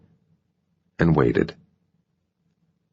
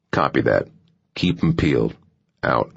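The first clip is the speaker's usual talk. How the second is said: gravelly Southern radio sign-off; Southern accent; 4 semitones lower than usual. A narrator's reading. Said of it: "Southern accent".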